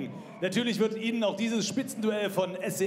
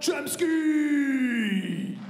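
high, slow, stretched ring announcer shout; drawn-out fighter name shout; 10 semitones higher than usual. Acted. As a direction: drag the name out over the crowd.

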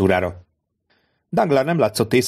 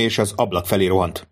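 ordinary delivery

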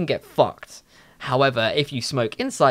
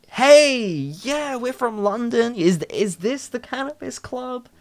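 raised pitch, bright eager asking; high, eager voice; 10 semitones higher than usual. Retold or imitated. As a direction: chirp up hopefully like a tourist. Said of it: "So we were like".

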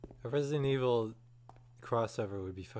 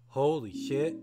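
surprised gasp; 4 semitones higher, 5 dB louder, slower than usual. Real, not acted. Finds a shotgun, gasps.

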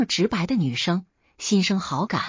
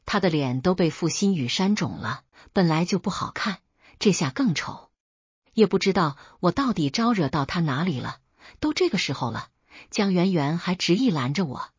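ordinary delivery

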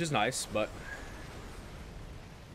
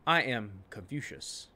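slow, low, softer declaration; mock solemn; 4 semitones lower, 7 dB quieter, slower than usual. Streamer baffled by the empty map, mock-solemn slow delivery. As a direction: intone it slowly like a sage.

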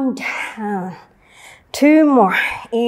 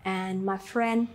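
calm cool-down; 4 semitones lower, 11 dB quieter than usual. Acted. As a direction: ease them into rest gently. Cool-down closing, soft and slow.

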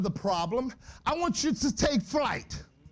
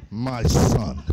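voice of God; 14 semitones lower, 8 dB louder than usual. Pretend. Imagined voice of God.

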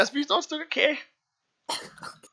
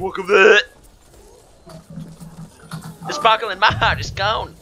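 loud; 7 semitones lower, 8 dB louder than usual. Real, not acted. Loud game outbursts.

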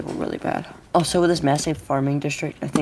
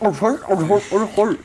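put-on Scottish accent; 6 semitones higher, 5 dB louder than usual. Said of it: "Scotland accent is just kinda annoying".

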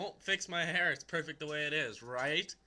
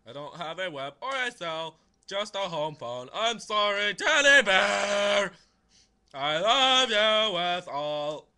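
loud recital building to drawn-out belting; hammed-up love letter, swelling to a sing-song holler; funny letter reading; 5 semitones higher, 14 dB louder, slower than usual. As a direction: start emphatic, end stretching the endearments out. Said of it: "I read them a funny way".

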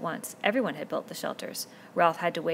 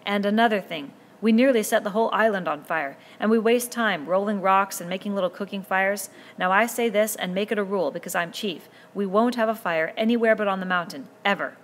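put-on a boy leader speaking up firmly to a noisy crowd; lightly voiced boy chief, louder than the narration; Ralph addressing the boys; 3 semitones higher, 8 dB louder than usual. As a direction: raise your voice to the assembly and hold their attention.

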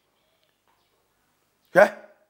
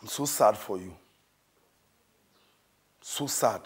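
soft pleading; 7 semitones lower, 6 dB quieter than usual. Acted. Preacher drops to soft pleading.

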